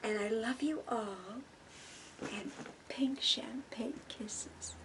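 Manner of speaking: breathy slow whisper; whispered sign-off. Acted. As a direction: whisper the kisses goodbye.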